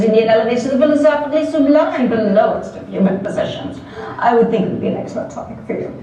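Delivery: fast, lofty pseudo-British lecture; pseudo-British lecturing. Acted. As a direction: rattle it off like a lightly faked British don. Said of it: "in his crisp, pseudo-British accent".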